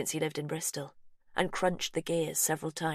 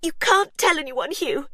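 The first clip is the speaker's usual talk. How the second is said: much higher, loud plea; Verity's desperate cry; 11 semitones higher, 9 dB louder than usual. A narrator's reading. Verity crying out to Hugh in panic. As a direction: beg in panic. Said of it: "Verity cried".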